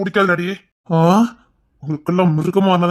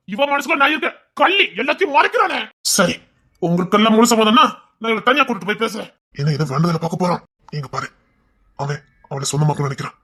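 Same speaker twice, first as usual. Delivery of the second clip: usual speaking voice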